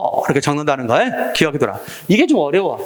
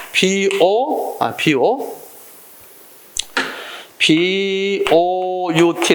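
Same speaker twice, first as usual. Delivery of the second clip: drawn-out, sound-by-sound "비오유티"; spelled out slowly; 2 semitones higher, much slower than usual. Shown as a demonstration. Teacher breaking down boat's sounds for students, spelled out slowly. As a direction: stretch each piece of "비오유티".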